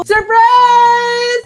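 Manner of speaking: high, loud surprise shout. Acted. Girlfriend reveals the party, loud high excited shout. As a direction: burst out with the reveal.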